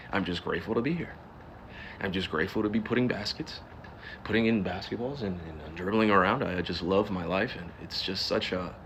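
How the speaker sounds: low, soft, polite interview voice; private-school media voice. Acted. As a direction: put on a meek, well-schooled voice for the press. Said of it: "hear me talk like I come from a private school".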